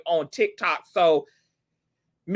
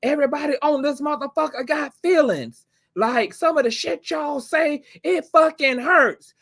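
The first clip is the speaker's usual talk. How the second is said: imitating near tears; 9 semitones higher than usual. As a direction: act out someone about to cry over hurt feelings. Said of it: "on the verge of tears when she was just like".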